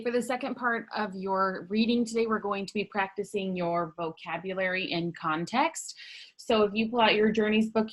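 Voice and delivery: normal talking voice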